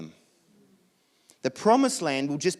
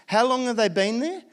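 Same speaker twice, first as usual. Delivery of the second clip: usual speaking voice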